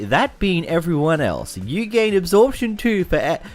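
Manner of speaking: normal talking voice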